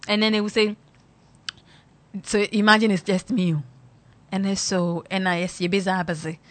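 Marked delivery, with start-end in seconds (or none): none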